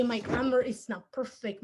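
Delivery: ordinary talk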